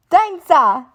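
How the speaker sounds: put-on very high wondering toddler coo; toddler George. A narrator's reading. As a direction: coo it like a tiny piglet in awe.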